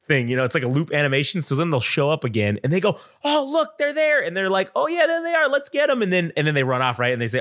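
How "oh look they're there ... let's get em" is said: put-on much higher, eager childish voice; excited kid voice. Kids seeing the cartoon dogs again, in a high excited kid voice. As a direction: mimic kids spotting them.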